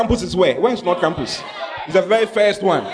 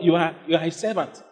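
ordinary delivery

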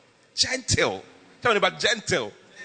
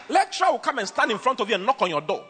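normal talking voice

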